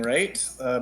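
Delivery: ordinary talk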